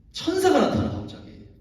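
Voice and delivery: storyteller's big reveal; dramatic reveal. Real, not acted. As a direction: pitch leaps high on 천사가. Dramatic announcement of angel.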